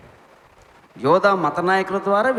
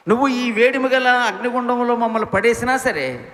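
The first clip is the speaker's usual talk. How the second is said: defiant declaration; 6 semitones higher, 4 dB louder than usual. Acted. Youths defy the king.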